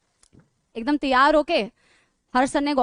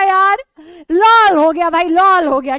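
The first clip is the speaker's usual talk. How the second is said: loud laughing exclaim; 7 dB louder, slower than usual. Real, not acted. Calling out amid laughter.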